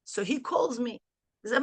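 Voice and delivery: ordinary delivery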